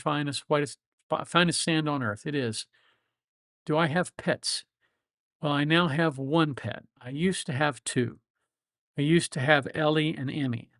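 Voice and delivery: normal talking voice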